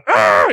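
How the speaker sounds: short mock growl of frustration; imitated toddler tantrum growl. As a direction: mimic a mad little kid growling. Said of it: "she gets so mad".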